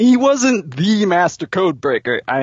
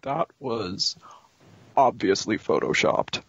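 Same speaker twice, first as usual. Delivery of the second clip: quiet mumble; 7 semitones lower, 7 dB quieter than usual. Acted. Quiet defensive excuse.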